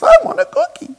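very high, loud mock wail; toddler on a loop. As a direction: imitate a toddler's insistent howl. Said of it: "she was on this loop".

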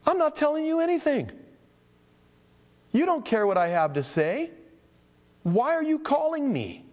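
high-pitched, fed-up retort; mock exasperated pastor, slowing at the end; exasperated outburst. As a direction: let the frustration spill out. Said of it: "you just want to say to him".

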